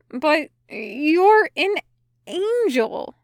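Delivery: slow, high, baffled protest; puzzled and halting; confused. A narrator's reading. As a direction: draw it out in bewilderment, pitch climbing. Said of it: "Mephistopheles repeated, confused".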